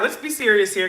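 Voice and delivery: ordinary talk, nothing marked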